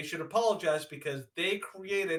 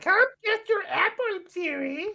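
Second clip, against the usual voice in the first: very high, sing-song fairy-tale witch; 14 semitones higher, 4 dB louder than usual. Acted. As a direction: put on a high, coaxing storybook witch voice.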